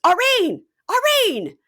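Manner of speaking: loud high call across the restaurant; yelling a name. Retold or imitated. Boyfriend calling the waitress back, loud high shout.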